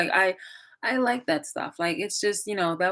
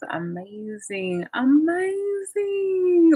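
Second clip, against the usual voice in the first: slow drawn-out cry, high and loud on the second; gushing amazement; 12 semitones higher, 4 dB louder, much slower than usual. Pretend. Vlogger pretends to gush over a friend's gift, crying out amazed. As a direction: stretch it out in awe.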